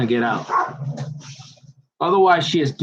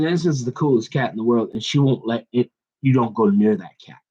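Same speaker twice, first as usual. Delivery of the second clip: ordinary talk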